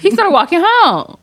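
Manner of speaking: can't believe he walked home; incredulous. Real, not acted. Telling friends the date walked home, incredulous.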